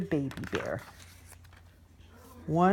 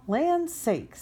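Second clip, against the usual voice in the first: much higher mama-bear cry; mama bear exclaiming; 10 semitones higher than usual. Acted. Mama bear reacting to her chair, high exclamation. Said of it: "in her middle-sized voice".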